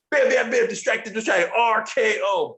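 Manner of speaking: hammed-up wrestler reeling off his lines; mock catchphrase barrage. Mocking Orton rattling off catchphrases.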